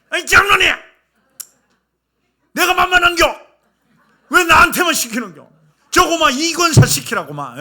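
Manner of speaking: high, loud indignant dialect protest; why always me, outraged; mock angry old lady. Deaconess snapping at elder over kitchen duty, angry.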